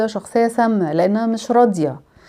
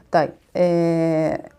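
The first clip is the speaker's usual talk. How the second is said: normal talking voice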